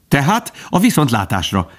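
high-pitched, hurried goodbye; hasty, tossed-off. A narrator's reading. Bambi takes leave of the old stag, hastily. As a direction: throw the farewell out in passing. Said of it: "vetette oda Bambi sebesen".

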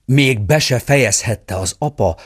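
plain reading voice

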